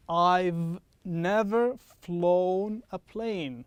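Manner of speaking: very slow, every sound in "I've never flown a plane" drawn out; slow demo. Shown as a demonstration. Teacher slowing the sentence down so students hear the v. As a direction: stretch each word so the v in "I've" and "never" is clear. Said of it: "I'm going to say this slower".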